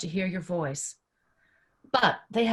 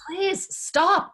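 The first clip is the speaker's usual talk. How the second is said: exasperated plea; 10 semitones higher, 3 dB louder than usual. Acted. Pleading with the interrupting recording.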